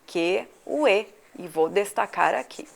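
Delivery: ordinary talk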